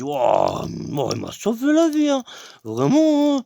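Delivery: high, rising amazement; amazed thought. Retold or imitated. Thinking of the guard who saved him, amazed. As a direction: marvel out loud.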